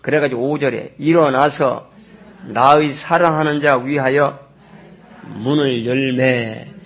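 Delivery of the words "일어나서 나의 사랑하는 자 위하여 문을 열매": slow, louder verse reading; leading a read-along. Shown as a demonstration. Pastor leading the congregation in reading verse five, slow and loud. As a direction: read it out slowly for them to repeat. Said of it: "따라하겠습니다".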